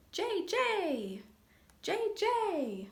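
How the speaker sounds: high, sharp jay calls; drilling jay cry; blue jay shriek. Shown as a demonstration. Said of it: "Blue jay's shriek is as sharp as a drill".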